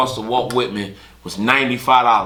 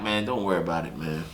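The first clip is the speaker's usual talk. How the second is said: lower, softer calming words; reassuring; 8 semitones lower, 11 dB quieter, faster than usual. Retold or imitated. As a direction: soothe him quietly. Calming the man quietly.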